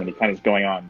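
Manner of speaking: ordinary delivery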